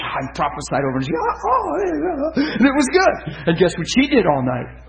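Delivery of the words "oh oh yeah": high-pitched mock wailing; imitated overcome wail. Woman overcome under prophecy, imitated high wail. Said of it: "she wiped out".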